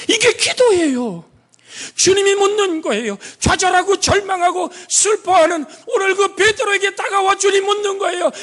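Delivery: high, loud fervent preaching. Acted. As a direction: plead with the congregation. Preacher at full force.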